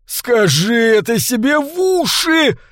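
high, slow, drawn-out shriek; furious squeal. A narrator's reading. Maître d' screams at the bleeding psychotherapist, furiously squealing. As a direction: screech it furiously, stretching the vowels. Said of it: "яростно визжал метрдот".

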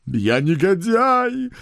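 high, loud, slow howl; wailing. Acted. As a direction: wail in despair. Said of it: "вопил он".

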